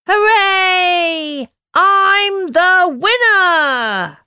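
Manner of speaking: high, loud cheer of triumph; warm beaming sun voice. Acted. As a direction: shout it like a proud winner. Sun celebrates her win.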